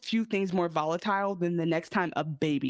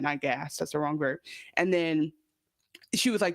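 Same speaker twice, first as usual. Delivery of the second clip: ordinary delivery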